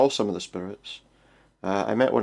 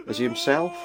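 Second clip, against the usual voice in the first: wailing; 3 semitones higher than usual. Acted. Broken man wailing.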